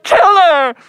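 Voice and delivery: high, loud melodramatic scream; mock upset cry. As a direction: shriek it like a hammed-up movie heroine. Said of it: "is really upset".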